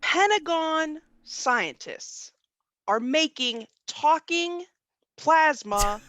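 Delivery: very slow, word by word; deliberate slow delivery with pauses between phrases; slow repeat. Shown as a demonstration. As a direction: spell it out slowly so it sinks in. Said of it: "unless you repeat it again slowly".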